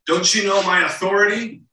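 a hint of an irritated governor; frustrated Pilate. Pilate frustrated with the crowd, mildly indignant. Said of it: "Pilate at this point gets frustrated".

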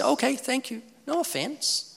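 high, light polite brush-off; a hint of a stranger waving him off. Stranger politely declining his tract, high and light. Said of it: "And they say".

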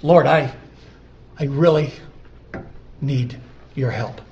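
halting, earnest plea to God; heartfelt prayer. Acted. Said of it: "No, it's".

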